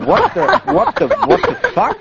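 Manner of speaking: high, fast disbelieving yelp; shocked disbelief. Retold or imitated. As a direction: squeal the words in mock shock. Ungläubiger Ausruf im Arztbericht. (hoch und schnell, gespielter Schock)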